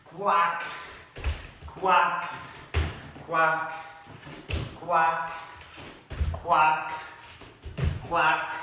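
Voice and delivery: loud, high quacks, one after another; quacking frog sounds. Shown as a demonstration. Teacher leading kids in frog quacks, loud and high. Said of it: "you can even make frog sounds like".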